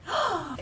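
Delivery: soft gasp of delight; quiet, high intake of breath; awed gasp. Real, not acted. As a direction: gasp softly, love-struck. Overcome with love for bag.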